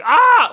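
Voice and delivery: sudden high, very loud yelp; hammed-up scream of a man who gets grabbed; mock scream. Firefighter grabbed by Michael, mock scream. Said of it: "he grabs it and he screams".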